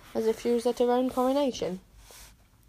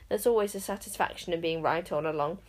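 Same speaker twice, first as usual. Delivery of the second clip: usual speaking voice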